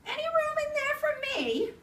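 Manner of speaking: high owl voice asking in. Acted. Owl asks to come in.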